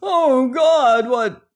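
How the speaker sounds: high, loud cry of shock; horrified gasp. A narrator's reading. Resolution finding the wounded Joanna, horrified gasp. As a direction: cry out aghast. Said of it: "he gasped".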